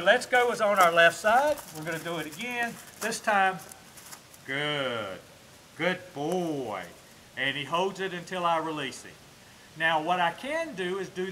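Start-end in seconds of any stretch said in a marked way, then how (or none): 4.4-6.8 low, slow praise for a dog; drawn-out praise. Real, not acted. Trainer praising his dog as it obeys, low drawn-out praise. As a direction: stretch it out soothingly, pitched down.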